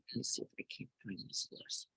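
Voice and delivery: whispered, fast, under the breath; muttered aside. Real, not acted. To herself, looking for a verse, a fast whisper. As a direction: whisper it to yourself while searching. Aside while searching the book.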